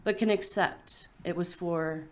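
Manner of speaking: solemn, measured poem recital; poetry reading. Acted. Poet reading an epitaph for a dying mother, measured recital. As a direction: set down each phrase with a pause after it. Reads an elegy aloud.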